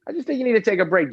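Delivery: high, light mock voice of the boss; quoting the boss. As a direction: play the boss brushing it off. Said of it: "And he was like".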